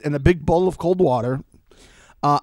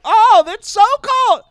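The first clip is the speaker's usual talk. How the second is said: high, louder, slow mock squeal; mocking her reaction; 9 dB louder than usual. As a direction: ham up her dainty shriek. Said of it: "and she was like".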